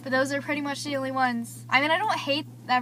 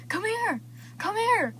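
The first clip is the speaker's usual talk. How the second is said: calling out; 7 semitones higher, slower than usual. Retold or imitated. Brother calling her over.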